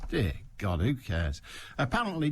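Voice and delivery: normal talking voice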